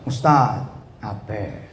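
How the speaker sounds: low, fading mock feebleness; mock listless plea. Preacher imitates a caller he is tired of hearing, listless. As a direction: let it trail away weakly, imitating a dreary caller. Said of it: "aduh, gua udah malas denger".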